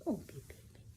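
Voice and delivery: usual speaking voice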